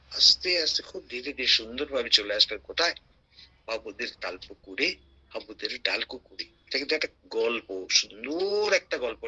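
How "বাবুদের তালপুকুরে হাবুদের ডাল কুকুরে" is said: lower, softer rhythmic recital; soft rhyme recital. Acted. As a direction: say the rhyme softly and low.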